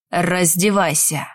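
low, slow cold command; barked order. Acted. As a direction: order it coldly, slowly. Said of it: "приказал маг".

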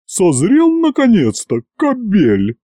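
high-pitched scolding character voice; scolding matron. A narrator's reading. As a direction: scold him in front of everyone. Агриппина бранит Ивана при народе. (высокий бранящий голос)

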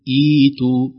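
إيتوا begun with a clear ee sound; starting form. Shown as a demonstration. Teacher showing how to start with إيتوا. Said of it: "لو بدأنا".

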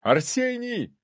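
high, alarmed call; alarmed yell, not full force. A narrator's reading. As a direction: cry the name out high. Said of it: "Я заорал так".

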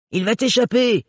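urgent shout. Acted. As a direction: yell a warning across the pier. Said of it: "cria Gaël".

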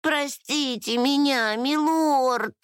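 high-pitched, pleading lament; scared little fairy begging forgiveness; frightened wailing. A narrator's reading. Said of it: "запричитала Лала, внезапно ещё больше испугавшись".

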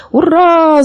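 high-pitched hurrah; drawn-up, ringing crowd cheer; cheering shout. A narrator's reading. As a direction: cheer like an excited spectator. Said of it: "закричали зрители".

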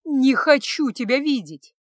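loud, drawn-out desperate cry. A narrator's reading. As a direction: throw it at him loudly. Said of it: "Вскрикнув, Аня побежала". (громко, отчаянно вскрикнув)

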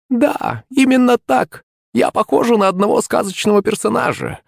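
high, forced-smile crone voice; sweetly fake, through a forced grin; sneering fake sweet. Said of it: "выдавила улыбку старуха".

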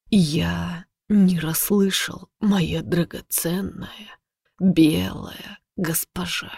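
slow, soft mocking menace; taunting predator. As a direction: toy with her like prey. Predator taunting his captive.

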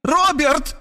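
high shrieked name; woman shrieking to stop the lecture. A narrator's reading. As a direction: squeal his name to cut him off. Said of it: "взвизгнула она".

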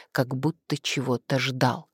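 ordinary delivery, nothing marked

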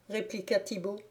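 ordinary delivery

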